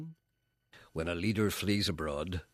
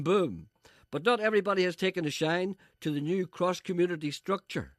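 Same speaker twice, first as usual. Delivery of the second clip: brisk louder newsreader delivery; news read; 8 semitones higher, 4 dB louder than usual. Acted. Reading a comic news item to listeners, louder. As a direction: read it like a local bulletin.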